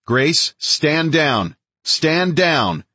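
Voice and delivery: high, drawn-out urgent order; yelled command. A narrator's reading. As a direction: shout each word slowly, pitch well up. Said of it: "I yelled".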